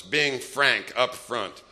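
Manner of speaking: ordinary talk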